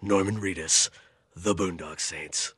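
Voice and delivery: low, soft trailer announcer; movie trailer voice. Pretend. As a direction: read the credits like a trailer. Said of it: "that was actually pretty good".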